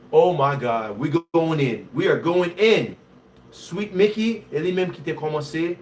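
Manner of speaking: normal talking voice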